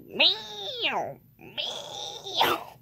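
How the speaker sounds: high, long, soft meows; cat meow imitation. Player correcting the animal noise, high cat meow. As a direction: meow high, sliding in pitch. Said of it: "imagine if Frogger was here".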